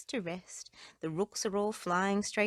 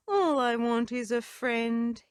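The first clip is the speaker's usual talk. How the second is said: sighing; 4 semitones higher, 5 dB louder than usual. Acted. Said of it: "he thought with a sigh".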